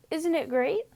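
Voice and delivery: put-on high, quick, cheerful young sheep voice; Wilbur the lamb. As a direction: chirp it like a proud little lamb.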